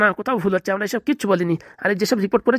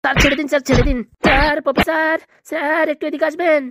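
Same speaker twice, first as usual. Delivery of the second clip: frantic pleading; 6 semitones higher, 4 dB louder than usual. Acted. Doctor pleading, calling professor.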